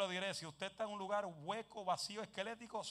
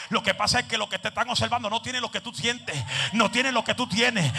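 loud, higher preaching shout; fiery preaching; 6 semitones higher, 24 dB louder than usual. Acted. The preacher shouts at the congregation to move freely. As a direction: exhort the congregation at full voice.

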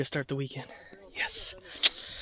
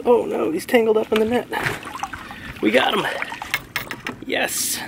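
excited; 8 semitones higher, 6 dB louder than usual. Real, not acted. Fish tangled in the net.

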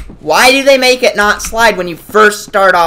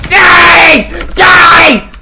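furious shout; 12 semitones higher, 10 dB louder than usual. Real, not acted. Gamer screaming at an enemy player, furious shout. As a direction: yell it high and loud at the screen.

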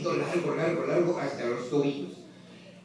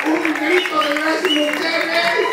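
slow, much higher, much louder rally cry; crowd call; 9 semitones higher, 7 dB louder, much slower than usual. Real, not acted. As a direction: whip the crowd up. Hypes crowd to cheer.

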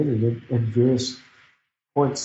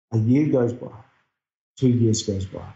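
ordinary talk, nothing marked

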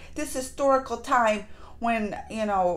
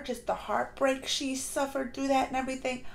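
ordinary talk, nothing marked

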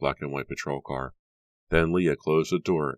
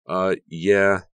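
higher, louder awkward reply; embarrassed; 5 semitones higher, 8 dB louder than usual. A narrator's reading. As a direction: cover the embarrassment badly. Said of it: "unable to conceal her embarrassment".

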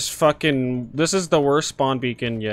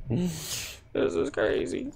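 unvoiced, much softer whisper; 12 dB quieter, slower than usual. Real, not acted. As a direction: breathe it out in disbelief. Whispered then squeaked repeat.

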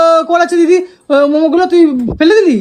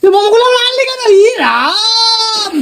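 high-pitched wail of disgust; appalled outcry; 8 semitones higher, slower than usual. Real, not acted. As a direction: drag the words out in a high, protesting whine. Appalled at momos brought back.

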